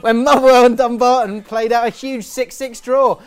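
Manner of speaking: loud, high trivia announcer voice; excited fact announcer. Acted. Delivers a niche football fact.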